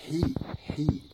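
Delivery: hi breathed unvoiced from the back of the throat; throaty breath. Shown as a demonstration. Teacher showing the guttural hi, breathy. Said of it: "du fond de gorge".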